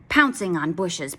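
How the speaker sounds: ordinary delivery, nothing marked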